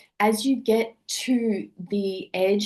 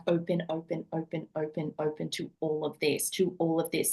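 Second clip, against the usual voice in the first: gentle, softer coaching voice, trailing quieter; soft coaxing; 3 semitones lower, 6 dB quieter than usual. Retold or imitated. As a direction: coax softly like a calm guide. Coaching clients to open up.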